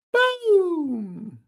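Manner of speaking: long, high drawn-out exclamation; celebratory high-pitched holler; excited call-out. Real, not acted. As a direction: stretch it out high and ringing. Calling out over the game.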